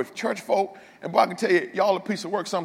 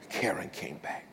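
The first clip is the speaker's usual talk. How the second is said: whisper; 12 semitones lower, 14 dB quieter than usual. Retold or imitated. Punchline after crying story.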